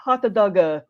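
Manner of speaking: "hot doga" with an added a at the end; imitating Japanese tourist. A Disneyland worker imitating Japanese tourists ordering, light mock accent. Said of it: "I worked at Disneyland".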